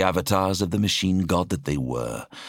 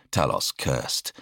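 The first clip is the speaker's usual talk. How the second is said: ordinary delivery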